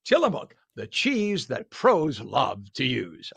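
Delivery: hammed-up commercial pitch; ad announcer voice. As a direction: sell it like a TV ad. Mock ad slogan for sponsor.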